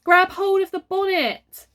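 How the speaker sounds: high, loud command; ordering. A narrator's reading. Father orders Myrtle to grab the car bonnet. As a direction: bark an order at her. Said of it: "he ordered Myrtle".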